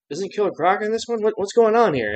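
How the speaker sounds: high-pitched, puzzled questioning; retold confusion. Viewer wondering where the villain went, mock confused. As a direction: act out being thrown by what you're watching. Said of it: "I was super confused".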